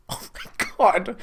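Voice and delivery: a laughing breathy whisper of disbelief; stunned whisper. Real, not acted. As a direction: whisper it, overwhelmed. Reacting to the sad story.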